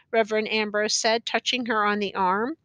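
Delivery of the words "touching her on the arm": usual speaking voice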